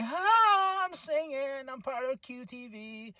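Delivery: high, sing-song ditzy voice; a hammed-up chirpy girl; mock bubbly Harley. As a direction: parody her old cutesy persona. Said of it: "She went from being this, like".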